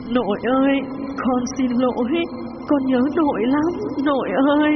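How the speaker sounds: slow, high, tearful apology; mournful, drawn-out ghost child's cry. Acted. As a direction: weep out your longing for grandmother. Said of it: "nó bắt đầu khóc".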